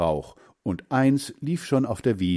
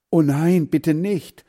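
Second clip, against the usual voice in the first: higher, louder plea; pleading cry; 7 semitones higher, 6 dB louder than usual. A narrator's reading. Hans Frieder begs Heinz to spare ducks, crying out. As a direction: call out to stop him. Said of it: "rief Hans Frieder".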